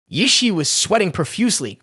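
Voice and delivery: ordinary delivery, nothing marked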